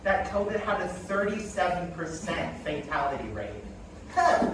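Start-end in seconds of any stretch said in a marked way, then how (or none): 4.1-4.5 dismissive dummy voice, raised pitch; Aunt Karen puppet voice. Acted. Dummy aunt brushing off the death rate, scoffing.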